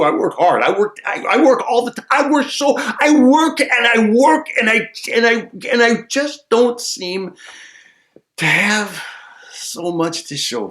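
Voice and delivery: high, building moan about overwork; mock exasperation.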